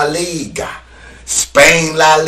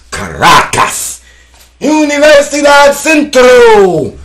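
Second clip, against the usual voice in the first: hype shout; 10 semitones higher, 6 dB louder than usual. Real, not acted. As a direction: roar the club names like an announcer. Shouting Venezuelan teams.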